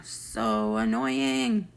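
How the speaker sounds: very drawn-out, fed-up complaint; exasperated. Real, not acted. Vlogger venting about Savannah, exasperated. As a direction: stretch each word in exasperation.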